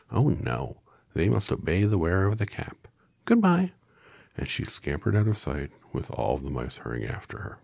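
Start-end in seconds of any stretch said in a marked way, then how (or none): 0.1-3.7 very high, tiny squeak; squeaky mouse voice. A narrator's reading. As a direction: squeak like a little mouse queen. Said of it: "in her squeaky little voice".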